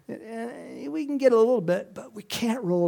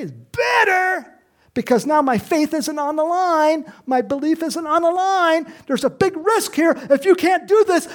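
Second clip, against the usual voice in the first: high, loud, urgent protest; frantic pleading; 8 semitones higher, 6 dB louder than usual. Retold or imitated. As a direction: beg him not to risk it. Martha voiced arguing with Jesus.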